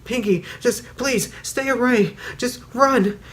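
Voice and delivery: high, desperate pleading; terrified warning. Retold or imitated. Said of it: "she held it out and she was like".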